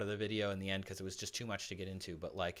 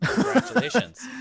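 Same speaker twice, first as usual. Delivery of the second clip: mock congratulations; 14 semitones higher, 15 dB louder than usual. Mock praise for therapy.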